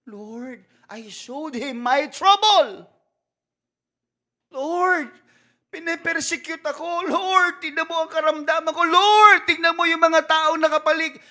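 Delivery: high, loud, anguished cry to the Lord; loud pleading cry. Pretend. Pastor crying out to the Lord for the congregation, loud and high. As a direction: wail it out, begging God to look.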